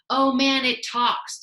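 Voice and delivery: bully screaming a taunt; screamed taunt. A narrator's reading. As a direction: yell it at the kid to mock him. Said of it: "screamed Eddie".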